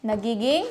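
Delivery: TH turned into T, nagiging; Filipino TH as T. Shown as a demonstration. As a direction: say nagiging with TH as plain T. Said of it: "yung unvoiced T H natin".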